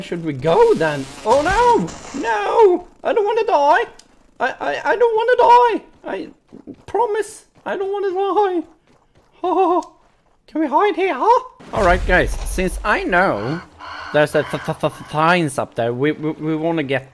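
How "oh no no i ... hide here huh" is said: very high-pitched frightened whine; panicked pleading. Real, not acted. Player begging not to die in the game, panicked pleading. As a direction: plead for your life in a high squeal.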